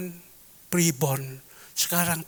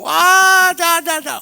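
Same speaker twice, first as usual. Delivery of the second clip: put-on old friend's protest; 13 dB louder than usual. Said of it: "friend answers".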